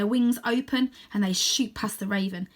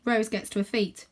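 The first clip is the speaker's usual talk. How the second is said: ordinary delivery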